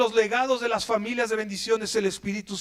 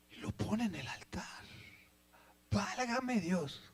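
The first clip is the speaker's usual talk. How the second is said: hushed awe; 3 semitones lower, 14 dB quieter, slower than usual. Acted. Laying son on the altar.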